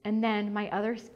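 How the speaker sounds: ordinary talk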